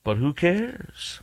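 slow, drawn-out sneering mimicry; mocking sneer. Host mocks the senator's dismissive words, slow and sneering. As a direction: drag it out to ridicule him.

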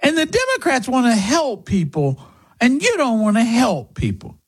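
high-pitched mock whine of an idealistic youngster; naive young person. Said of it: "young people go".

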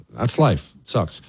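normal talking voice

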